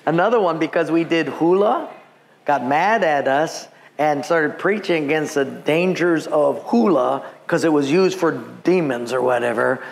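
usual speaking voice

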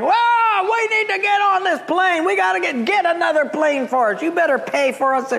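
a hammed-up angry crowd yell; imitated yelling crowd. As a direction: bellow like the stranded passengers. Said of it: "are yelling".

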